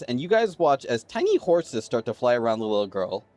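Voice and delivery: ordinary delivery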